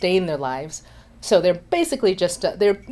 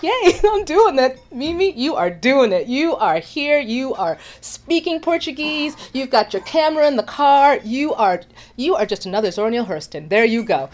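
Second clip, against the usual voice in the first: excited self-cheer; 6 semitones higher than usual. Retold or imitated. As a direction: gush at yourself with joy. Cheers herself on.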